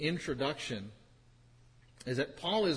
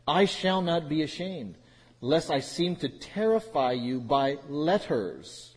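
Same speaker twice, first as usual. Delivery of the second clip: louder, measured reading of the text; scripture reading; 6 dB louder than usual. Acted. Reads scripture aloud.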